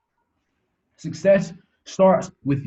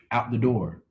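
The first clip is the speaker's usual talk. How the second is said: ordinary talk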